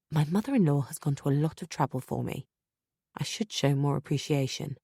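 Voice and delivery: ordinary delivery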